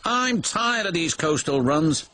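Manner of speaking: high-pitched, weary complaint; peevish high voice; grumbling James. A narrator's reading. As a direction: grumble like an overworked engine. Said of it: "grumbled James".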